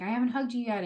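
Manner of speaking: lightly voiced mentor, keen for a hug; eager mentor. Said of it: "she was like".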